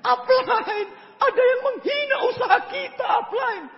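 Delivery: high-pitched whining plea; high tattletale whine; high whiny call to upline. Retold or imitated. Calling to upline complaining.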